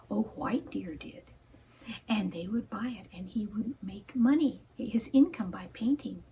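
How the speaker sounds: soft hushed whisper, telling a tale; bedtime-story whisper; whispered storytelling. A narrator's reading.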